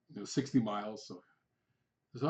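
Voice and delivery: ordinary delivery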